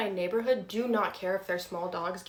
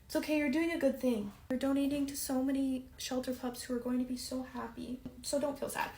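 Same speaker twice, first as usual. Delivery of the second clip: reassuring dog; 7 dB quieter than usual. Real, not acted. Comforting her sad dog.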